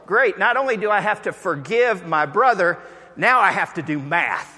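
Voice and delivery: high, loud comic groan of dismay; loud, laughing mock despair; dismayed guy. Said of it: "And the guy goes".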